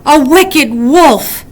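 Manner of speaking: slow, far-off alarmed call; alarmed cry. A narrator's reading. Weasel crying out as the wolf swaggers close. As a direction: warn them of the wolf. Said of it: "cried Weasel".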